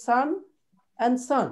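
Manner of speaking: both words as sun, with the same open a; same pronunciation. Shown as a demonstration. Teacher shows there is no difference, same open vowel. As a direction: say sun identically both times, no o sound. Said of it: "this is same pronunciation".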